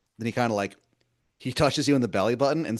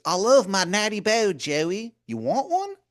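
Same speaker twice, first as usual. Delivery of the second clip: much higher and louder, a sweet-talking wizard calling you hun; Baltimore wizard voice; 12 semitones higher, 5 dB louder than usual. Acted. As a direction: wheedle like a pushy old wizard begging a favor. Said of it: "He goes".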